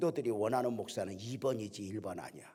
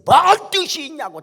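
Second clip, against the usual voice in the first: very high, very loud angry retort; outraged deacon shouting back; indignant rant; 18 dB louder than usual. Retold or imitated. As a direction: scoff hard at the idea of a purpose. Deacon ranting about pastor.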